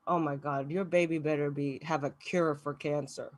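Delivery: embarrassed aside after sounding Republican, soft and low; quiet aside. Real, not acted. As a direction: drop it low and sheepish. Self-conscious mutter.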